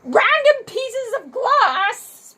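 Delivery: high amused question; surprised echo. Real, not acted. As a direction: echo it back, amazed. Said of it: "child answer echoed".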